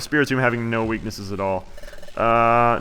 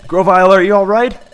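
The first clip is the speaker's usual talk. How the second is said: acted alarm; 6 semitones higher, 7 dB louder than usual. Voicing worried hero in game dialogue.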